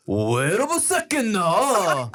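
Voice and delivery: slow, high put-on Japanese accent. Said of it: "but just with a Japanese accent".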